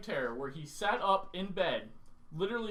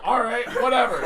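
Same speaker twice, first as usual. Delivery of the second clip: exasperated dismissal; 5 semitones higher, 10 dB louder than usual. Retold or imitated. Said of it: "I'm like".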